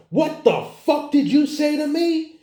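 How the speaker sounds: very high-pitched imitation of an outraged woman; flipping out. Angry bar customer turning on the man who said sweetheart, high-pitched mock outrage. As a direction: shoot up high, mimicking her furious outburst. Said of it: "this bitch fucking flips a shit".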